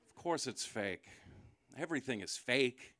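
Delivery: ordinary talk, nothing marked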